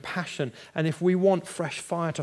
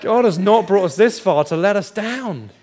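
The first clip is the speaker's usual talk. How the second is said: loud, high, proclaimed with force; loud declaration; 6 semitones higher, 11 dB louder than usual. Shown as a demonstration. Preacher proclaiming the child's words to the congregation, loud. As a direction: declare it boldly to the crowd. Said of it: "need to say that over your situation".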